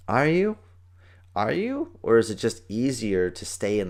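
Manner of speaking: high, rising doubtful question; skeptical challenge. Retold or imitated. Challenging a friend's too-busy excuse, skeptical. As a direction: question the excuse with a big upward lilt.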